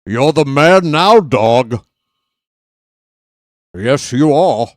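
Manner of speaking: booming Scottish Connery line; Sean Connery impression. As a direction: hit it harder like Connery. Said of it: "nineties Connery joints".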